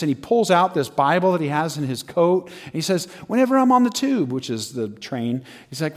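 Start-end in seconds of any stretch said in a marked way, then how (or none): 3.3-4.3 high, earnest pleading voice; imitated mild-mannered protester; meek protest. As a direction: plead your case as the accused believer. Accused man pleads his faith.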